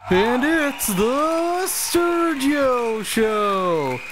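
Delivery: high, slow, booming game show announcer; grand announcer. Acted. Announcer finally introducing the show, grand and booming. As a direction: stretch the title out like a TV announcer. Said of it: "keep it grand and intrusive".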